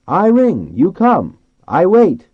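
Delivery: loud, high-pitched call; calling through cupped hands. A narrator's reading. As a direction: holler each phrase out across the water. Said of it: "he cupped his hands".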